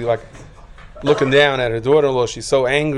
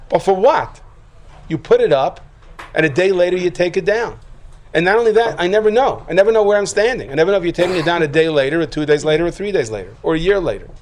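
louder, higher, driving preacher's cry; impassioned preaching; 6 semitones higher, 4 dB louder than usual. Acted. Rabbi crying out to the congregation about the Mishkan, impassioned. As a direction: build it up like a pulpit climax. Preacher rises to full force.

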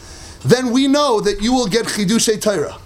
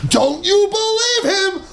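"Don't you believe him?" pitched high and slowed, "you" leaned on; mock-stunned high question, drawn out; incredulous question; 9 semitones higher, slower than usual. Shown as a demonstration. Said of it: "This is the way we talk".

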